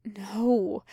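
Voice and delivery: breathless, surprised wheeze; wheezing. A narrator's reading. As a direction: push the word out on a wheeze. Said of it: "Crowley wheezed in surprise".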